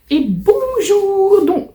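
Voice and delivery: cheery greeting. Real, not acted. Greeting opening new video.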